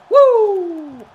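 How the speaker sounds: high, loud victory whoop; triumphant whoop. Real, not acted. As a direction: let out a relieved cheer. Surviving elimination.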